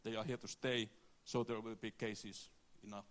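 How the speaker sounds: ordinary talk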